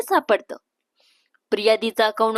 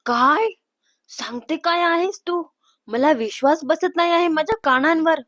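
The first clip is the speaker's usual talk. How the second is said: higher-pitched disbelief; can't believe your ears; astonished; 4 semitones higher than usual. Acted. Sister hearing of the secret romance, astonished. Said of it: "तोंडावर हात ठेवून म्हणते".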